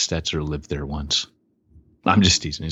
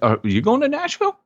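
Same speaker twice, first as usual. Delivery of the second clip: excited. Real, not acted. Excited banter.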